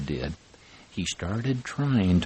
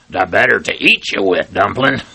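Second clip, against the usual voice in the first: loud, fast wolf growl; 10 dB louder than usual. Acted. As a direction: pounce with a snarl, the mask is off. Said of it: "The big bad wolf growled and said".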